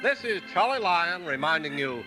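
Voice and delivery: ordinary talk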